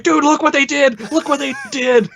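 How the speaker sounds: very high, giddy mock squeal; excited retold exclamation. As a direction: imitate a fan freaking out to a buddy. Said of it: "be like".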